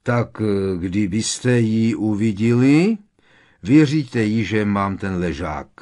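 slow, loud, halting character voice; Krum. Acted. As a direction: speak up heavily and haltingly, a bit sullen. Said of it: "Krum se už zase tvářil rozmrzele". (pomalý, hlasitý, rozmrzelý hlas postavy)

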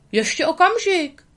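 high, loud call after them. Acted. The beaver calls after Tramp and Lady. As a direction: call them back before they go. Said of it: "volal bobr".

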